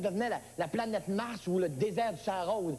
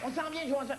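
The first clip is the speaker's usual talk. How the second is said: raised-pitch toy-soldier voice; rescuing commando; 6 semitones higher than usual. Acted. Commando answering Joe as they come to save him, high rallying call. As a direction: call back high and urgent. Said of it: "le commando des autres qui venait sauver Joe".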